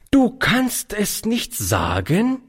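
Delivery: slow, high reproving echo; strafend. Acted. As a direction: repeat it slowly as an outraged rebuke, rising at the end. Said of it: "wiederholte sie in strafendem Ton". (in strafendem Ton)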